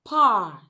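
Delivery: "part" with a stressed open "ah"; loud, full vowel in "part"; long vowel. Shown as a demonstration. Teacher reads fourth example word, long stressed vowel. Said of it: "this particular sound, sound occurs in words like".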